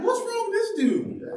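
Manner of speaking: very high, incredulous squeak; hammed-up baffled recruit; mock bewilderment. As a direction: pitch it way up in disbelief. Confused recruit about sergeant.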